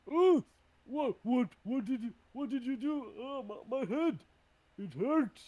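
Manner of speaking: high, loud yelps of pain; pained outcry. Acted. Giant struck by a dizzying spell, pained outcry. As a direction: cry out as if your head hurts. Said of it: "as their head starts to hurt".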